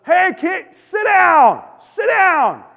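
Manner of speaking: put-on high, loud shout at unruly kids; imitated yell. Bus captain yelling at screaming kids, loud shout. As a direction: bark it at a bus full of kids.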